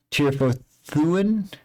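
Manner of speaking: slow, confused echo of a strange word; puzzled question. Acted. NPC repeats name puzzled.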